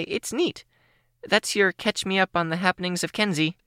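put-on high, bubbly teen girl voice; bright young girl voice, a little shy; Kenzie's voice. Kenzie speaks.

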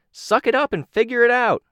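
high, loud impatient call. A narrator's reading. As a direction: yell it across, high and loud. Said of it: "Sidepiece called out".

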